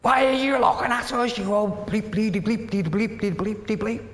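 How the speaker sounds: high-pitched imitation of sweary hecklers; mock abusive rant. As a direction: rattle off a censored tirade in mock outrage. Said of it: "they're effing and jeffing".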